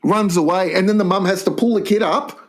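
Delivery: ordinary talk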